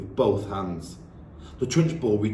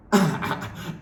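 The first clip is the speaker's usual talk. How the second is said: snort of laughter; 8 semitones higher than usual. Acted. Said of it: "snorted the Trunchbull".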